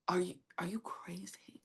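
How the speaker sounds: hushed, whispered disbelief; appalled whisper. Retold or imitated. Friends aghast at her confronting the robber, appalled whisper. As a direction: imitate stunned friends under your breath.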